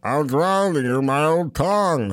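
slow, slurred giant; slowly slurred giant reply; slow giant mumble. Retold or imitated. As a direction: drool through it. Andre voice answering director.

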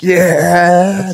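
high, drawn-out celebratory cheer; hyped-up, stretched-out whoop; crunk cheer. Retold or imitated. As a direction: re-enact a dad cheering from the sideline. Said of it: "And I was crunk".

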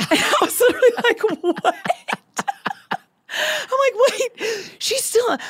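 high-pitched, laughing through the words; laughing disbelief. Real, not acted. Friend recalls being told to move on to her father, laughing disbelief. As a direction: break up laughing while mimicking your shock.

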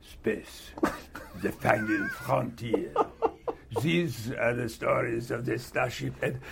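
hammy French accent, slow. Shown as a demonstration. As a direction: cod French captain voice. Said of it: "You wanna hear it?".

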